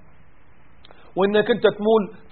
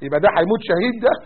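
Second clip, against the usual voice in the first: ordinary talk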